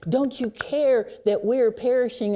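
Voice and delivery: desperate cry for help; frightened cry. Retold or imitated. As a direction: shout it like men in a sinking boat. Disciples cry out again.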